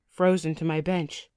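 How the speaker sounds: usual speaking voice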